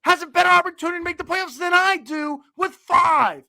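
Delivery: exasperated retold outburst. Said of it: "I'm like".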